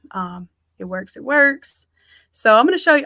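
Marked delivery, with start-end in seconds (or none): 0.8-1.2 softer, lower, half to herself; quiet. Real, not acted. Host deciding on the city photo to herself, quiet. 1.2-1.9 higher and louder, decided; firm. Real, not acted. Host confirming the city photo to her viewers, firm and louder.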